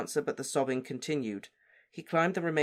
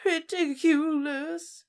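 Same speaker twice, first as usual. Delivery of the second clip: high, slow sob with a cry breaking through; a mother weeping as she casts, high and broken; sobbing; 5 dB louder, much slower than usual. Acted. Mother sobs the spell at the body, high and slow. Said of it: "Missus Weasley sobbed".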